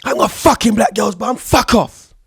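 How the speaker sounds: high, loud mock yell; imitating an enraged shout. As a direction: shout it like an outraged girl. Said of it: "She was like".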